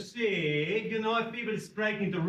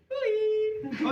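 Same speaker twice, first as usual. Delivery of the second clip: high-pitched sung-out call; very English call; 12 semitones higher than usual. Shown as a demonstration. Comedian teaching the audience their reply, high English call. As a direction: demonstrate a cheeky high call. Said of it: "very English thing, you go".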